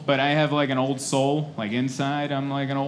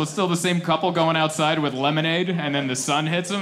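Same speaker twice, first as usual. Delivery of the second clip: usual speaking voice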